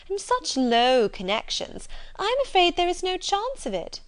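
condescending Mrs Hurst. Acted. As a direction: pity her from above with swooping emphasis. Reader voices Mrs Hurst.

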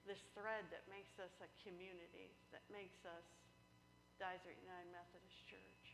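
soft, reverent prayer voice. Acted. Pastor praying softly over the congregation, reverent. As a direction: pray it quietly with feeling.

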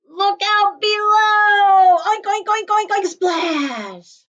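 high, loud, slow cannonball shout; gleeful jump cry. Acted. Pig shouts to Duck while jumping in, high whooping. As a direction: Pig hollers as he leaps in.

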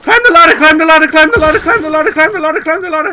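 high, loud frantic yelling; frantic shouting. Real, not acted. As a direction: scream it in real panic. Fleeing monster, urging partner.

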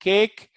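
"cake" with a clean k and no breath; unaspirated cake. Shown as a demonstration. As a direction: keep air off the k of "cake". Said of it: "to say".

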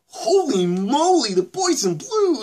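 high, loud mock amazement; ironic exclamation. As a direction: cry it out with exaggerated irony. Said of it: "He says it in this kind of ironic sense".

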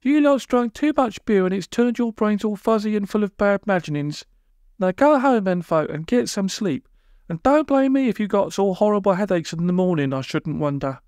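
firm high-pitched telling-off; high character voice, laying down the law; Polly. A narrator's reading. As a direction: scold them in a high put-on voice. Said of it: "said Polly firmly".

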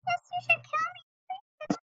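high, slow squeaky voice for the game character. Pretend. Kid voicing a game character, high squeaky and slow.

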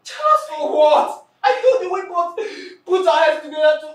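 bitter tearful outburst; crying out in disbelief. Acted. Betrayed friend crying out at the jealous attacker.

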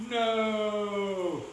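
high, long-held cry of horror; scream. A narrator's reading. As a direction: wail one drawn-out word. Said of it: "was the first to scream".

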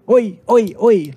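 loud, high, sharp call across the pit lane; angry calling out. Retold or imitated. Angry team boss calls out to the rookie driver, loud high shout. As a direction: bark it out to get the rookie's attention. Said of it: "the way he's calling me, I knew he was pissed".

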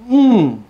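high, loud hum of delight; a long appreciative "mmm" over food; savouring mmm. Real, not acted. Savouring food during a tasting.

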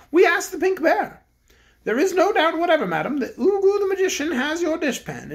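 the Bear King addresses the cook; kindly Bear King. Acted. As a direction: speak as a gentle storybook king. King speaks.

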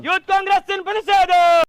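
shouted protest slogan. Real, not acted. (മുദ്രാവാക്യം വിളി)

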